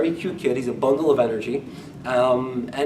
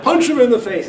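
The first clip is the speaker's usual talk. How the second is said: higher and louder, punched out; emphatic; 8 semitones higher, 7 dB louder than usual. Real, not acted. Preacher drives home what the verse doesn't mean, emphatic. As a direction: hit each word hard for effect.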